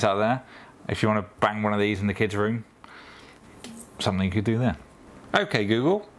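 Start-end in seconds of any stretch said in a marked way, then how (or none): 5.3-6.1 raised voice command. Real, not acted. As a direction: call out to the smart speaker. Waking the speaker by voice.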